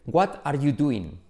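slow Spanish-accented "What are you doing?", each word apart; full T in "What" and full "are", Spanish schoolroom accent; careful Spanish learner saying "What are you doing?"; Spanish-accented English. Shown as a demonstration. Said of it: "Nos enseñan a decir".

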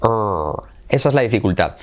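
ordinary talk, nothing marked

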